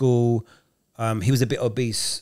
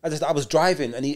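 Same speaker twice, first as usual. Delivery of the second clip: ordinary delivery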